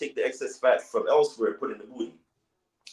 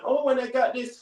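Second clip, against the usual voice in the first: high-pitched show-off parody; mock bragging; 10 semitones higher than usual. Mocking women who flaunt gifts from benefactors, high show-off parody. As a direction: lightly mimic a woman flaunting a gift. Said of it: "So when you hear them going".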